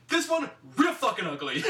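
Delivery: lightly voiced tough-guy insult; a hint of a sneering hard man; playful mock sneer; imitating Roddy Piper. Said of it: "Looks back at the old lady".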